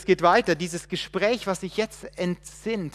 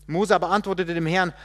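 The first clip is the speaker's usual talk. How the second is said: ordinary talk, nothing marked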